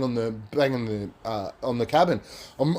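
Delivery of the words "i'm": usual speaking voice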